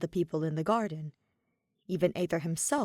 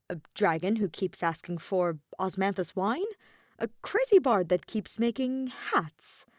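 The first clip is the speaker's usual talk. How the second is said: plain reading voice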